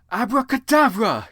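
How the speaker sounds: high, loud magic shout; yelled spell. Acted. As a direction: fling the spell at them. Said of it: "he yelled at Snape and Lupin".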